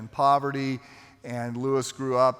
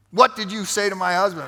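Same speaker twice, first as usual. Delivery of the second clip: mock confrontation, higher and louder; accusing demand; 5 semitones higher, 6 dB louder than usual. Wife confronting the man who made her husband cry, accusing. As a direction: demand an answer like an affronted spouse.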